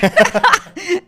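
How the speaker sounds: bursting laugh, high and loud. Real, not acted. Laughing aloud to the host at the stand-up idea, loud laugh. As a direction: let a real laugh burst out.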